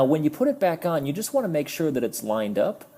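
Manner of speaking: ordinary delivery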